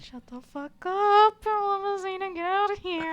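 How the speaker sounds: mock panic. Real, not acted. Panicked reaction to ghostly figure.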